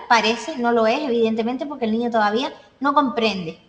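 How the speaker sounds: normal talking voice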